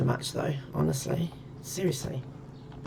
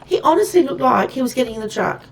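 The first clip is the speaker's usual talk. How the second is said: high, loud and fast panicked rush; real alarm; 13 semitones higher, 12 dB louder, faster than usual. Real, not acted. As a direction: blurt it out, heart racing. Startled by a possible thief.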